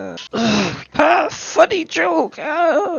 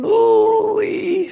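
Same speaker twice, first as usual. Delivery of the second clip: ordinary talk, nothing marked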